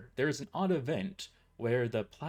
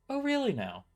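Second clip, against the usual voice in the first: higher mocking retort; sarcastic comeback; 8 semitones higher than usual. A narrator's reading. Employee mocking the boss's threat, pitched up. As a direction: toss it back higher and mocking.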